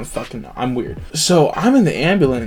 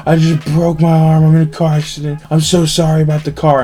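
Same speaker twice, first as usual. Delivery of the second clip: mock-sobbing confession, pitch slightly raised; retold sobbing; 3 dB louder than usual. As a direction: imitate tearful phone call to dad. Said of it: "pretty much sobbing on the phone".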